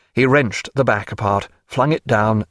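plain reading voice

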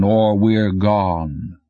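slow, heavy voice of a mountain; rumbling mountain voice. Acted. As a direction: speak slowly, like a mountain talking. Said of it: "its voice about what you'd expect from a mountain".

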